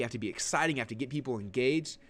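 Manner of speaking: ordinary talk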